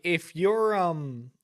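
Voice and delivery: ordinary talk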